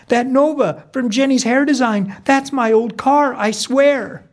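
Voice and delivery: high, urgent mom voice; agitated insistence. Acted. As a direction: insist in a high voice, alarmed at the discovery. Mom spots her old car.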